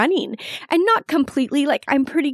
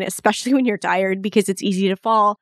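normal talking voice

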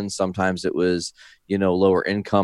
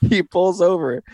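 words squeezed out higher through real laughter; can't get past the giggles; laughing through words; 10 semitones higher, slower than usual. Real, not acted. Telling a friend the gross story, laughing.

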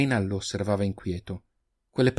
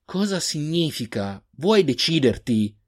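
higher, slower, snapping with impatience; impatient outburst; 6 semitones higher, slower than usual. A narrator's reading. Reynal to the old man, demanding answers, impatient outburst. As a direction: lose patience with the old man's stalling. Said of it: "sbottò Reynal spazientito".

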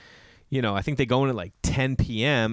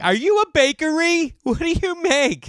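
much higher, louder squeaky mock question; mock confused people; 6 dB louder than usual. Mocking people who ask about the company name, high squeaky voice. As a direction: ham up baffled strangers asking. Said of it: "people are always like".

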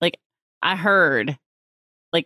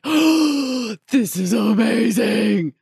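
big gasp, then long drawn-out awe; hammed-up amazed reaction, very slow; awestruck; 4 semitones higher, much slower than usual. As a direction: gasp and stretch it out slowly, higher. Retelling own reaction to pictures.

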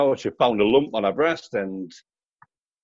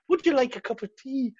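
much higher-pitched imitated voice; 11 semitones higher than usual. Star turns round to the bloke in a dress, high imitated offer. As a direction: lift it high for a polite offer.

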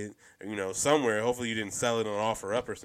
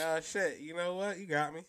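higher, softer mock admission; mock concession; 7 semitones higher, 6 dB quieter than usual. Imagining mom giving in to her child, lightly acted concession. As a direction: lightly act out giving in, soft and pitched up.

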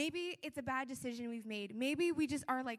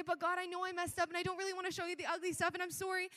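higher, hurried confession; rushed apology; 5 semitones higher, faster than usual. Acted. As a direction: blurt out the apology before being interrupted. Voicing a rushed apology to God.

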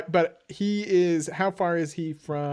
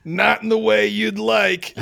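menacing reply; 5 semitones higher, 7 dB louder than usual. Acted. Player answers in character.

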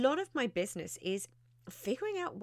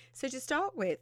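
yawning; 6 semitones higher than usual. Real, not acted. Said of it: "excuse me, little yawn".

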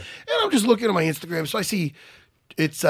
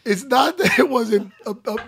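laughing through tears; 4 semitones higher than usual. Real, not acted. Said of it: "you're making me cry".

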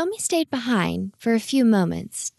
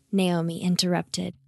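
ordinary delivery, nothing marked

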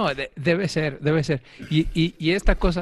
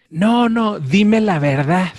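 much higher, louder insistence; alarmed wife, higher and louder, slowed; wife demanding; 7 semitones higher, 6 dB louder, slower than usual. Retold or imitated. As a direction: press hard for the truth. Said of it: "me dice".

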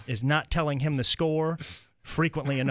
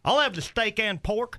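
higher comic uncle voice; raised-pitch character voice; stubborn uncle; 7 semitones higher than usual. Acted. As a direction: order confidently, like a clueless old uncle. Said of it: "he orders the heading".

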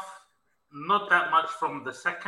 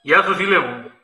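in a cave; 9 dB louder than usual. Real, not acted. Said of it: "you sound like you're in a cave".

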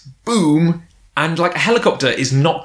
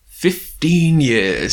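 slow, drawn-out pronouncement; a solemnly stretched mock quote; Fuyutsuki; 3 semitones higher, much slower than usual. Imitating the second in command, slow pronouncement.

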